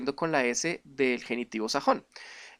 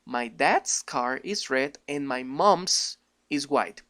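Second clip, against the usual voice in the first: very slow, careful English sentence; slow English demo; 2 semitones lower, much slower than usual. Shown as a demonstration. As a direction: read it out slowly for the class. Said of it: "quedaría".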